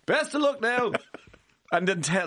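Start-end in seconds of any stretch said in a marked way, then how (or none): 0.1-0.9 high, laughing mock send-off; laughing, much higher; laughing mockery. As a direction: giggle through the silly picture. Mocking surgeon sending stent off.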